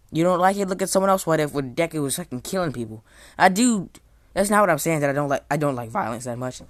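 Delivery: loud defensive rant at chat; heated shouting. Real, not acted. Streamer shouting back at chat who dislike his story, heated. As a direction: yell back at the critics, fired up.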